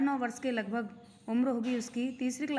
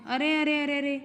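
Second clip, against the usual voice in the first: a hint of a boy groaning in pain, louder; 4 semitones higher, 5 dB louder than usual. A narrator's reading. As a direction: cry out as if the stomach hurts. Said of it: "वो दर्द के मारे कराता है". (दर्द से कराहता हुआ)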